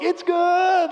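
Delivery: high, drawn-out sportscaster cry; imitating a triumphant referee's call; mock field-goal call. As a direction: call the kick good, teasing. Buddy calls it like field goal.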